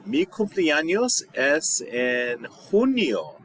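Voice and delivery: said slowly and a bit high; slow model answer. Shown as a demonstration. Teacher modelling a birthday answer slowly. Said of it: "What month is your birthday on?".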